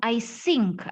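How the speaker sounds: "think" said as "sink", with a hissed s. Shown as a demonstration. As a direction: mimic the learners' mistake, "think" becoming "sink". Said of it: "justru Anda bilang". ("think" diucapkan "sink" dengan s)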